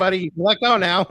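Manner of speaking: high, loud plea to be released; mock panicked pleading. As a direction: play at begging out of a bear hug. Acts out being crushed in hug.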